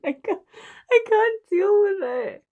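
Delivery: high, teary, laughing through tears; barely holding it together; choked up. Real, not acted. Fan moved to tears by the war episode, choked up. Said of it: "this is so hard".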